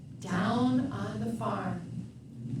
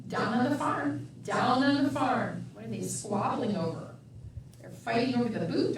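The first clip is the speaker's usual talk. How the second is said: ordinary delivery, nothing marked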